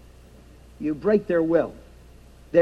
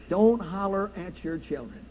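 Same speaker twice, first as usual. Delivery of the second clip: quiet; 4 semitones lower, 9 dB quieter than usual. Shown as a demonstration.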